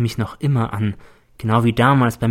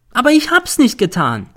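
much higher, louder denial; desperate cry; 12 semitones higher, 5 dB louder than usual. A narrator's reading. N pleading innocence, desperate cry. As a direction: plead your innocence crying out.

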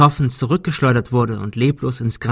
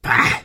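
short, voiceless burst of contempt; disgusted scoff; spitting disgust. A narrator's reading. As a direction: spit the syllable out. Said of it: "er spuckte aus".

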